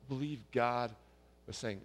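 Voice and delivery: usual speaking voice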